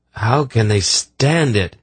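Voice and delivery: slow, nauseated question; gagging. A narrator's reading. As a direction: speak while holding back a gag. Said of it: "trying to keep from gagging".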